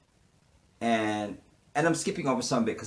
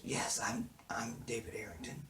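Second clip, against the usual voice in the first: whispered retold reply; hushed quote of the character answering the cop; 12 dB quieter than usual. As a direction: say your name under your breath.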